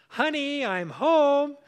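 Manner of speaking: loud, high call up the stairs; calling upstairs. Retold or imitated. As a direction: call out so she hears you coming.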